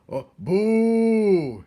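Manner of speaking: high, loud mocking boo; booing shout. A narrator's reading. Botticelli boos the weeping rat. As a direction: jeer at the rat. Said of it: "shouted Botticelli".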